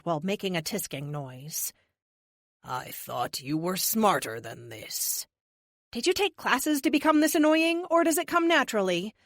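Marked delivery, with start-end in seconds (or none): 2.6-5.3 low, measured robotic villain; smooth and slightly slow menace; cold android leader. A narrator's reading. 5.9-9.1 biting sarcasm, higher; mock him with contempt; sarcastic. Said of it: "I ask".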